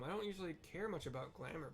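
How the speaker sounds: ordinary talk, nothing marked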